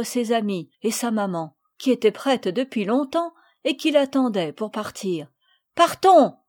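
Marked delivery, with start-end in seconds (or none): 5.7-6.4 high, loud call to set off; eager cry. A narrator's reading. Girls calling out to leave for the doll. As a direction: shout it out eagerly. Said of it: "s'écrièrent-elles toutes ensemble".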